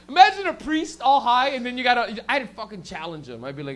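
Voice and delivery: normal talking voice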